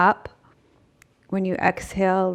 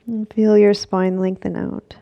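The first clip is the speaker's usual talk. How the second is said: louder, guiding stretch cues; yoga instructor voice; 2 semitones higher, 7 dB louder than usual. Acted. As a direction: lead the class through the stretch. Cues a forward fold stretch.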